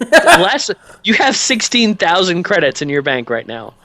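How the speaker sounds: high, loud laughing protest; amused disbelief. Real, not acted. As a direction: protest incredulously, starting on a laugh. Game master disputes player's poverty claim.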